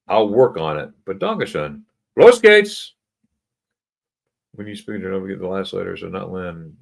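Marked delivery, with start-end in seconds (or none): 2.2-2.9 high, loud "Los geht's" burst; loud rallying cry. Acted. Host kicking off the game for viewers, loud and high. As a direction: shout it like a kickoff.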